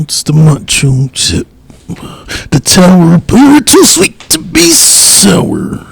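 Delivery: slow, big hype-man drawl; wrestling promo. Pretend. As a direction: cut a hammed-up wrestling promo. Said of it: "let me do my promo".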